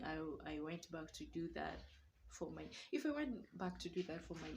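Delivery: ordinary talk, nothing marked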